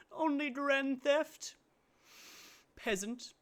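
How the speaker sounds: high, slow haughty sneer; high and mighty. Retold or imitated. Mocking the clip's snobs, a high slow haughty sneer. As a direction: look down on the small-time crook. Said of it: "really high and mighty laugh".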